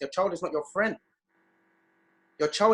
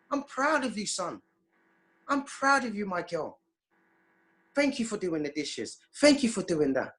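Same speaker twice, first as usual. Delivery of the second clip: put-on higher, tender praise to a child; a parent gently praising after the dishes; proud parent; 4 semitones higher than usual. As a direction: tell your son you're proud of him.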